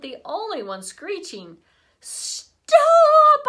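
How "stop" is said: high, loud shout. A narrator's reading. Boy shouting at Libby to stop, loud shout. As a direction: yell it to halt her. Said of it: "I shouted".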